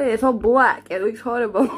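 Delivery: normal talking voice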